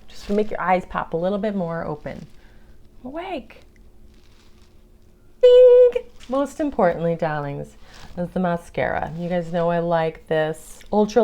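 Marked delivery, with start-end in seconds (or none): 5.4-5.9 loud high bing like a bell; playful bell-like bing sound; mock bing. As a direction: ring out a cartoon bing. Sound effect for eyes popping.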